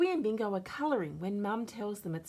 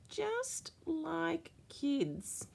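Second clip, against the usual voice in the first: high, very slow sly teasing; high, very slow playful tease; 13 semitones higher, much slower than usual. A narrator's reading. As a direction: draw it out playfully. Mum's teasing punchline.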